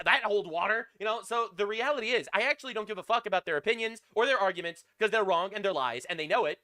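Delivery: ordinary delivery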